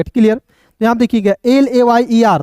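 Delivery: usual speaking voice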